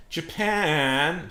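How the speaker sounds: loud, high, drawn-out call. Acted. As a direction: wail the name to a friend. Sad Italy calls to Japan.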